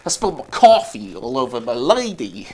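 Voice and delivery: put-on low, botched New York accent. Copying co-host's New Yorker, poor New York accent. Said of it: "This is what I shouldn't do impressions".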